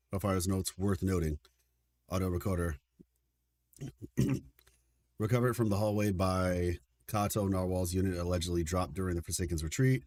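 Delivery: low, even reading of game notes; reading notes aloud. Acted. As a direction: read it like a case file.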